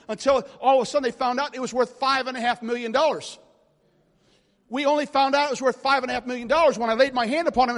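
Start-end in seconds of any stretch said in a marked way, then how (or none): 4.7-7.8 loud, building preacher cry; much louder sermon climax; preaching at full force. Acted. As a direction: drive it home to the crowd.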